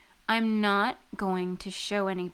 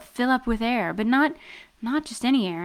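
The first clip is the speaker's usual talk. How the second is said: usual speaking voice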